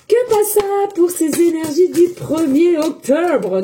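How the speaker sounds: a too-theatrical announcement, slowed right down; overdone intro. Shown as a demonstration. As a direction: draw the title out too grandly. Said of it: "Non, c'est trop".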